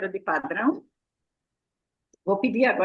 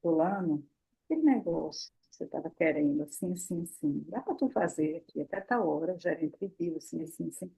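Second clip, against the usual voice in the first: low, soft coaxing ask to a coworker; coaxing a colleague for a favour, softly; soft request; 6 semitones lower, 8 dB quieter than usual. Retold or imitated. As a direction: ask gently, almost under the breath. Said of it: "chegava assim".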